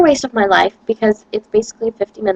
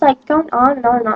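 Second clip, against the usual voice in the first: ordinary talk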